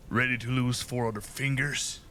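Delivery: taunting villain voice; threatening sneer; menacing bandit captain. Acted.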